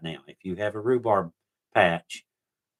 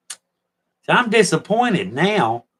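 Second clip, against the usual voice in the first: mock disappointment; 10 semitones higher, 6 dB louder, much slower than usual. Mock disappointment at plain coffee.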